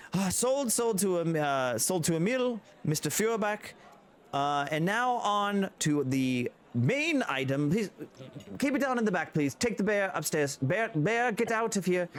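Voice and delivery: high, sing-song sale call; auctioneer. Acted. Auctioneer closing the sale to Emil, high sing-song. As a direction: close the sale cheerfully. Auctioneer sells and clears bear.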